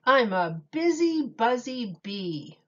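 lively picture-book read-aloud with big openings; reading to children. Acted. Miss Julie reading Dr. Seuss to children, animated storytime voice. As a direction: read it like storytime for little kids.

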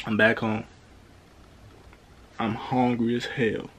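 ordinary talk

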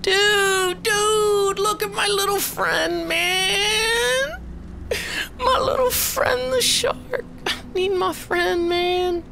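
very high, drawn-out mock plea; high pleading whine. Gamer longing for his little shark friend, high mock whine.

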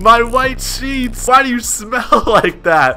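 high, drawn-out whiny complaint; mock outrage. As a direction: act a horrified homeowner, laugh creeping in. Acts out owner's outraged messages.

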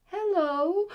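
a cub's playful roar of hello. A narrator's reading. Lion cub roaring hello to the animals. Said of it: "roars the lion cub".

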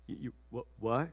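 soft, low, stammering disbelief; stunned Joseph. Acted. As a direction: lose your words in shock.

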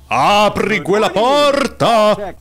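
slow, high, loud shouted command; mock movie shout. Gamer shouting at the door like a movie. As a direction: bellow it like a horror film hero. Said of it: "voglio fare come".

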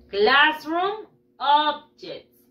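very slow, word by word for pupils; modelo para repetir. Shown as a demonstration. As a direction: stretch out "Classroom objects" for repeating. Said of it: "Repeat after me". (modelo lento para repetir)

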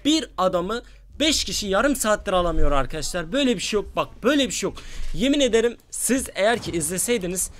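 loud, high exasperated ranting; real gamer fuming about the match; furious rant. Real, not acted. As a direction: vent your anger at the viewers. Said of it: "sinir küpe olurdunuz".

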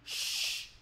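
SHH as one long voiceless hush; a sustained "sh" to show the sound. Shown as a demonstration. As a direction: hold SHH with no vowel. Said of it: "S H mình đọc là gì".

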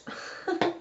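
quiet, breathy little chuckle; short laugh. Real, not acted. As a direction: chuckle softly at your own slip. Laughs at own slip.

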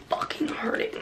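whispered through the sting; pained whisper. Real, not acted. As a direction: whisper it in pain. Whispered complaint of pain.

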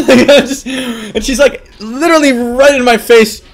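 high, loud and laughing through the words; giggling, caught out; embarrassed laughing. Real, not acted. Telling chat about the hookah girl, laughing.

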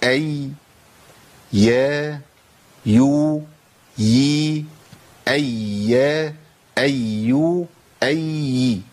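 each syllable أي يا يو يي voiced clearly and drawn out; demonstrating ya sounds. Shown as a demonstration. Teacher modelling ya sounds for students to repeat. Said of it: "تاني وركزوا معايا".

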